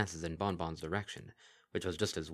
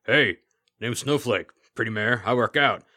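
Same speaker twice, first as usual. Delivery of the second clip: louder, gruff and grating meathead; 3 semitones higher, 10 dB louder than usual. Acted. The Kelpie as Snowflake to Bon Bon, gruff and grating. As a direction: bark it like a gym bro. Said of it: "said in a gruff, grating voice".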